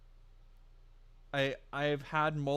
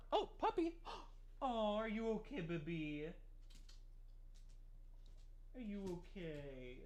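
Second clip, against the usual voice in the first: worried parent; 6 semitones higher, 9 dB quieter than usual. Real, not acted. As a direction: coo anxiously to the puppy. Dog hurt under chair.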